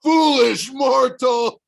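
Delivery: loud, slow, high monster roar; bellowed. A narrator's reading. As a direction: boom it like an angry giant. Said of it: "he bellowed".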